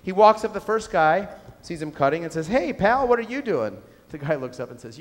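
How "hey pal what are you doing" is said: higher, louder hailing call; mock-cheery shout of hello; friendly call. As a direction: call out cheerfully to a worker across the site. Frenchman hails a stonecutter.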